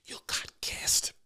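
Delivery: hushed, hurried tip-off; urgent whisper. Retold or imitated. Church greeter quietly warns the pastor about unusual guests, whispering. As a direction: whisper it so the guests won't hear.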